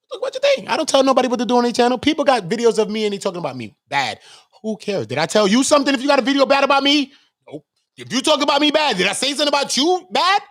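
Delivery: loud, high-pitched indignation; defiant, fast ranting; heated rant. Real, not acted. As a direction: shout down the critics.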